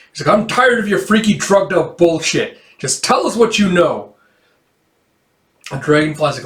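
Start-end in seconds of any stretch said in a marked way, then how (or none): 0.4-4.2 high-pitched exasperated demand; fed-up anger. Acted. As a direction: act out running out of patience. Gale confronts Weird angrily.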